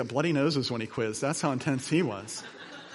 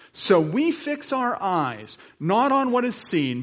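higher, louder preacher's read; preaching scripture; 5 semitones higher, 6 dB louder than usual. Acted. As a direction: proclaim the verse with fervour. Reads scripture with fervour.

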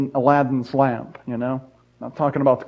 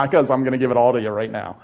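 sly genie; 5 semitones lower, faster than usual. Acted. Evil genie answers British guy.

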